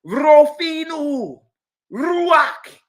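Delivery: Rofinu and Ruach with an exaggerated rolled R, high, loud, slow; hammed-up rolled R in Ruach mocking Hebrew learners. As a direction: roll the R in Rofinu hard. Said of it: "They roll the R's".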